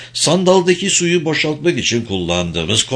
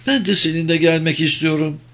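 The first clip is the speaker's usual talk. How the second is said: higher, lightly voiced woman; woman's line in a higher register; voiced female companion; 6 semitones higher than usual. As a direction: lift the pitch for the woman companion.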